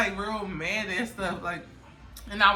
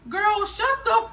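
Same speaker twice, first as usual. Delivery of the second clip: high, loud exasperated mom; mock scolding; 11 semitones higher, 8 dB louder, slower than usual. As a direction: draw it out loudly, imitating a fed-up mother. Retold mom scolding her daughter.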